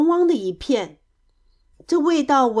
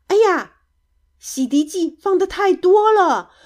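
high, loud "oops"; loud, high-pitched dismay; dismayed exclamation; 8 semitones higher, 6 dB louder than usual. A narrator's reading. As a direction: exclaim at a mistake. Dismay at too much detergent.

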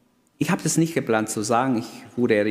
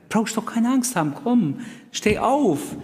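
soothing comfort; 12 semitones higher than usual. Retold or imitated. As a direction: coax him up tenderly. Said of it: "Er sagt nicht".

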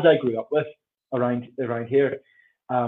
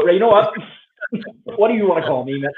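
loud, raised, half-laughing exasperation; mock exasperation; 5 semitones higher, 7 dB louder than usual. To someone mishearing the name, mock exasperated. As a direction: give up, playfully fed up. Acted giving up on being misheard.

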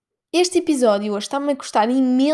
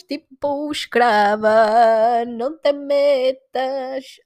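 gloating tease; 3 semitones higher, much slower than usual. Pretend. Teasing boastful holiday voice.

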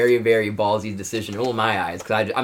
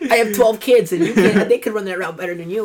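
mock Rivers rant; 10 semitones higher, 5 dB louder than usual. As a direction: rant at him like the fussy quarterback. Imitating Rivers berating receiver.

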